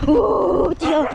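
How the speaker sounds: startled shout. Real, not acted. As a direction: yelp as the dog bolts. Dog suddenly runs.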